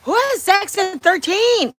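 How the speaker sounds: high, loud, slow disbelief; shocked at the thought of it; incredulous. Real, not acted. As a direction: stretch it out, flabbergasted. Incredulous outburst.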